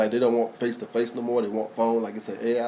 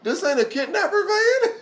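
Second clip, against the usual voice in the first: much higher, slow little girl imitation; slower than usual. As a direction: mimic a small girl asking innocently.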